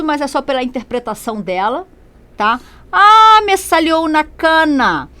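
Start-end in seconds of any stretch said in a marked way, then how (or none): 2.9-5.1 much higher and louder, a dismayed cry drawn out slowly. Acted. Teacher voicing the comic strip woman finding a grey hair, dismayed cry. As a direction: wail it like a shocked cartoon woman.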